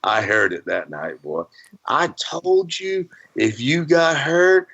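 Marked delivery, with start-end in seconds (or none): 1.9-4.7 high wife's voice, hammed-up telling-off; mock scolding wife. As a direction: play the furious wife scolding her bloodied husband. Wife scolding him that night.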